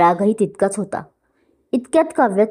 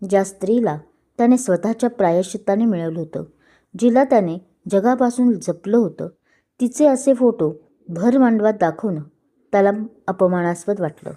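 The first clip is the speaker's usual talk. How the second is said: plain reading voice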